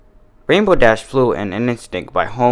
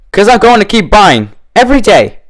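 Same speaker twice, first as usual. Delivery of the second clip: high, loud promise; drunken glee; 10 semitones higher, 7 dB louder than usual. A narrator's reading. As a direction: boast with tipsy delight. Drunk Dash boasting.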